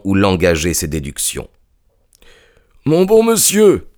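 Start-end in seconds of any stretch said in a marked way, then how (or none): 2.8-3.9 high-pitched, drawn-out impatient protest; exasperated, high exclamation. A narrator's reading. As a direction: cry out with impatience, voice pitched well up. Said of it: "s'écria non sans impatience".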